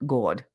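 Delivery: "God" as GAWD; Jersey accent. Shown as a demonstration. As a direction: draw the AW in "God". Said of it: "So it comes out like".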